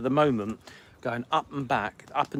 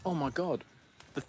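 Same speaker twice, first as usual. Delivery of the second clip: normal talking voice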